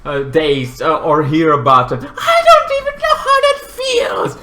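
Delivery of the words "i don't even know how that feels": squeaky, very high put-on voice; mock lament. Vlogger joking about being away from mother, high mock lament. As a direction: wail it up high in mock distress.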